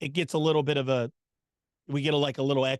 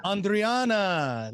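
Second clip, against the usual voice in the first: light Spanish-style accent, drawn out slowly; a little accent; 4 semitones higher, much slower than usual. Shown as a demonstration. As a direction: roll the name with a slight Spanish accent. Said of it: "I said it with a little accent".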